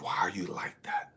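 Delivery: soft, breathy, almost whispered question; a quiet superior puzzling over him; hushed commander's question. Retold or imitated. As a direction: ask it gently, under the breath. Commander questions him, voiced softly.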